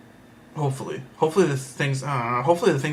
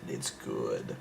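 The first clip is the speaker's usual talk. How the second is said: deep croak; 14 dB quieter than usual. Real, not acted. Teasing secret girl name.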